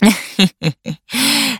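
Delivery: acted nasty jackal chuckle; sly villainous little laugh; nasty cackle. A narrator's reading. Jackal laughs at the tiger's complaint, nasty cackle. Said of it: "خندهٔ کریهی کرد و گفت".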